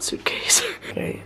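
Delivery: amused unvoiced whisper. Real, not acted. Unpacking late at night, whispering at her clothes pile. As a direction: breathe the words out in disbelief. Surprised by all her stuff.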